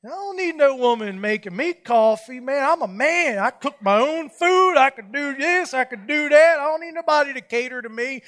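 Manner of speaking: mock-proud grumbling to himself; proud defiant rant. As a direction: puff up like a wounded man. Pride welled up, defiant rant.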